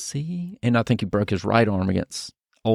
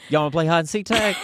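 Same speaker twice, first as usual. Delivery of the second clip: put-on playful kid; 7 semitones higher than usual. Teasing playground invitation.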